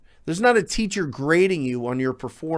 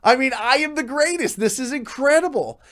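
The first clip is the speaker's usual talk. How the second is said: cocky boasting; 7 semitones higher than usual. Retold or imitated. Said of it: "And we're like".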